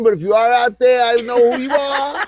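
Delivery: high-pitched playful call-out to debtors; mock warning. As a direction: call them out in mock menace, voice climbing. Calls out friends owing dinners.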